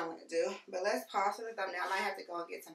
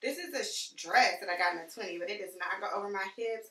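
ordinary talk, nothing marked

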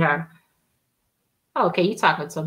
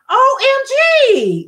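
high, loud, drawn-out squeal; slow, piercing mock squeal of disbelief; amazed exclamation; 14 semitones higher, 5 dB louder, much slower than usual. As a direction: imitate an astonished friend, stretching each letter. Retells friend's amazed reaction.

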